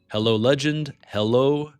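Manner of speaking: ordinary talk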